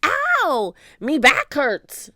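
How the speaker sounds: loud, slow complaining groan of a pirate; slow loud pirate complaint; pained pirate cry. A narrator's reading. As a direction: moan about his sore back. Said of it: "Stinky cried".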